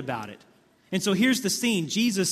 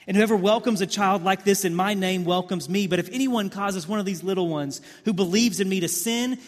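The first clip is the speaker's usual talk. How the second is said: forceful scripture preaching; 3 semitones higher, 4 dB louder than usual. Acted.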